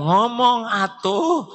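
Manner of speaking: wife nags her silent husband, high and sharp; nagging wife. Acted. Wife complaining to her husband, high-pitched scolding.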